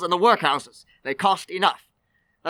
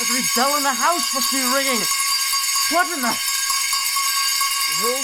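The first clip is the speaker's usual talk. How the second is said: alarmed; 6 semitones higher, faster than usual. Acted. Scrooge alarmed by ringing bells.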